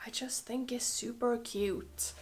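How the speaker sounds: unvoiced, soft adoring hush; whisper. Real, not acted. Whispering adoration of the Chanel jacket to viewers. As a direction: whisper it like it might hear you. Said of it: "I feel like I have to whisper".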